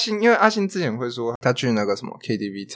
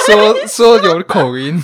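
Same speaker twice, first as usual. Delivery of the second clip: laughing, drawn out; 7 semitones higher, 9 dB louder, much slower than usual. Real, not acted.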